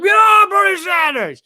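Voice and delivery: high, drawn-out fan shout; mock wild cheer. Imitating people voting for Bernie Sanders, wildly enthusiastic. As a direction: whoop it like a rallying supporter.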